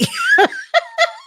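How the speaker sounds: a high burst of real laughter. Real, not acted. Host laughing at the husband's "possibly" excuse, high and loud.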